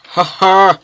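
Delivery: loud, mocking show-off laugh; hammed-up "ha ha" laugh, dragged-out boast between; Stampy Longhead. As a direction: boom it out in a mocking impression. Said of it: "do a Stampy Longhead laugh".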